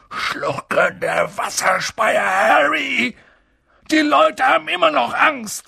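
rising, agitated outburst; exasperated. A narrator's reading. Giant explaining people's fear to Harry, exasperated. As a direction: build from a curse to a high protest.

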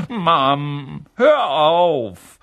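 high, loud wail at mum; embarrassed boy's loud complaint; whining protest. A narrator's reading. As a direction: squirm away and whine it. Boy protests nose being wiped.

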